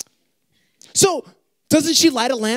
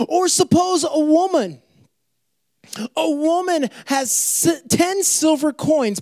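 slow, weighty reading of the parable; drawn-out storytelling read; slow reading with pause; 5 semitones higher, slower than usual. Shown as a demonstration. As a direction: read it slowly, letting each word land.

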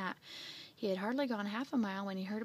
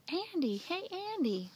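high-pitched call from afar; shouting; 8 semitones higher than usual. Acted. Bill's mother hailing Andy from the porch, shouting. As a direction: hail him in a high calling voice. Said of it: "he heard a shout".

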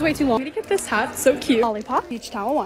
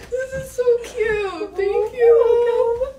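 slow, high, teary and laughing; real happy crying over a gift; tearful thanks; 9 semitones higher, much slower than usual. Real, not acted. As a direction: thank her through happy tears. Friend receives gift basket.

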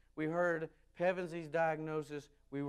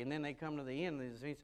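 normal talking voice

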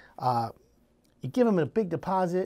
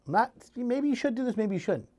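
ordinary delivery

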